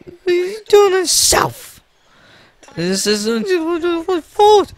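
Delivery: put-on very high little-kid whine; upset kid's high-pitched protest; wailing child. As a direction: complain tearfully, ending in a bawl. Child wails at father from shelf.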